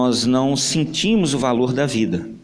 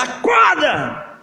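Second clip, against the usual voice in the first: drawn-out loud angry yell, much higher; shout; 11 semitones higher, 4 dB louder, much slower than usual. Acted. Mother yanks the blanket and yells at her son to wake up. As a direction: yank the covers off and scream it. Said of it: "começou a gritar".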